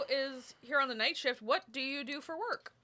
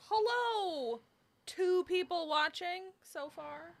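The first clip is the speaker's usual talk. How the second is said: excited; 7 semitones higher, much slower than usual. Real, not acted. Greeting live stream viewers.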